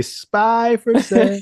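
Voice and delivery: high, slow sing-song cry; peddler's call. Retold or imitated. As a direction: sing it out like a street vendor. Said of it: "I guess immediately".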